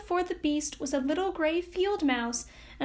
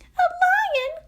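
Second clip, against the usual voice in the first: very high, loud frightened squeak; alarmed cry; 6 dB louder than usual. Acted. Mouse queen hearing about the lion, alarmed. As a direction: yelp in alarm. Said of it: "cried the little queen".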